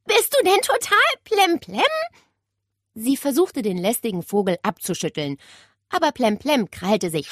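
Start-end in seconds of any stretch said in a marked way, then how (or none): 0.0-1.8 high, loud indignant question; annoyed outburst at a pesky bird; cross shout. A narrator's reading. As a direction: yell it in exasperation. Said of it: "rief Nele sauer".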